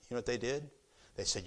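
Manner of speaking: ordinary talk, nothing marked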